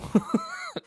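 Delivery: laughing. Real, not acted. Laughing at own joke.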